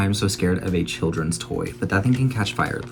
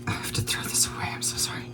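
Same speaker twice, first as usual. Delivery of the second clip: whisper; 10 dB quieter than usual. Real, not acted. Apologizing while tossing ruined pizza.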